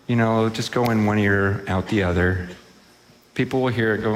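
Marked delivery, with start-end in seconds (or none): none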